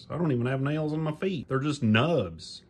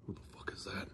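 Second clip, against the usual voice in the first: softer hushed question; whisper; 7 semitones lower, 19 dB quieter, faster than usual. Acted. Puppet whispering about the barking noise. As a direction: whisper it warily.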